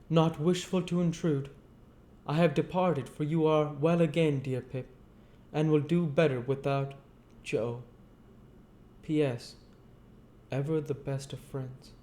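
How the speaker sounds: simple man saying goodbye; kindly Joe voice. Acted. Joe's farewell letter read.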